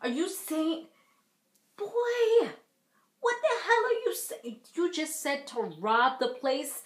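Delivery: shocked disbelief. Retold or imitated. Reacting to Puma's robbery talk, high-pitched disbelief. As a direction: play it stunned in disbelief. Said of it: "I'm like".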